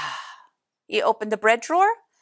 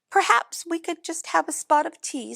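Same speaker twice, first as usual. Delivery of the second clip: mouse voice; 8 semitones higher than usual. A narrator's reading. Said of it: "said the mouse".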